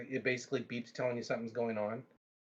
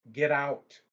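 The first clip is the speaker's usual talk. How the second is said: a sudden louder, final "get out"; angry order; 7 dB louder than usual. Retold or imitated. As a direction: throw him out of the house. Throwing the cleaner out.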